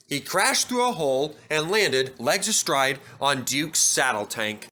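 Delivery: usual speaking voice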